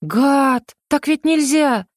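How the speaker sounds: high-pitched, heartfelt indignation; indignant. A narrator's reading. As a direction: protest in sincere outrage. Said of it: "искренне возмутилась я".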